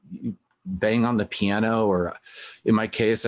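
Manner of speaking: usual speaking voice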